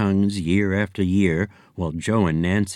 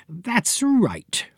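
higher, lightly voiced Miss Hunch; prim fortune teller; 10 semitones higher than usual. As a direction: delicate seer confessing a weakness.

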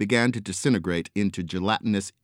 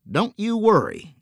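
reassuring; 13 semitones higher, 4 dB louder than usual. Acted. Angel reassures the pig.

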